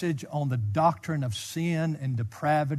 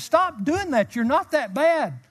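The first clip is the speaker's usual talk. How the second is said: higher, scolding teacher's rebuke; 9 semitones higher, 3 dB louder, slower than usual. Retold or imitated. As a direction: quote a well-meant telling-off, pitched up. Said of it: "rebuked me and said".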